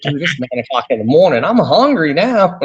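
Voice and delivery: mock whine, drawing out the last words; mock complaint. Host to guest describing food at breakfast time, mock complaint.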